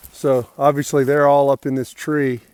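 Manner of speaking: usual speaking voice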